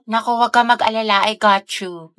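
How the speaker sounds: ordinary delivery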